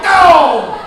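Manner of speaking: high, loud frantic no; panicked shout. Retold or imitated. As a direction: yell while slapping the radio off. Panicked stopping radio.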